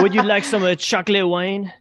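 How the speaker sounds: a touch of hammed-up French host; mock French accent. Friend joining the joke, lightly put-on French accent. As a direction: offer the wine like a cheesy French waiter. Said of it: "the French accent".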